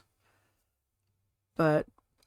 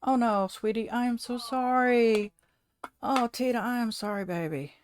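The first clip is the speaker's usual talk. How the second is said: pet talk; 6 semitones higher than usual. Real, not acted. Consoling the sick pet.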